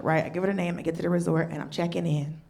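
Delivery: ordinary delivery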